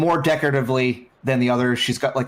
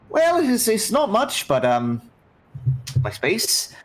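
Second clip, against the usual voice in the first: lightly voiced captain; 9 semitones higher than usual.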